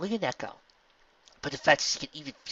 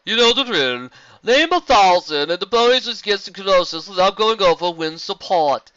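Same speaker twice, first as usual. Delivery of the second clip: nasal yell=82; 8 semitones higher, 12 dB louder than usual. Acted. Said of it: "a nasally voice yelled".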